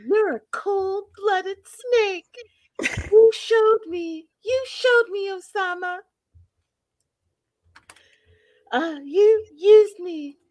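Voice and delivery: high, slow, drawn-out mock anguish; Debbie impression. Acting out a betrayed woman accusing Osama, high drawn-out voice. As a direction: stretch each accusation out high and wronged. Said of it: "And she's like".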